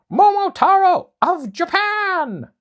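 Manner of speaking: very high, loud, slow proclamation; dog announcing. Acted. Dog announces Momotaro's entrance, loud slow herald. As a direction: herald him in like a crier. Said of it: "the dog called out".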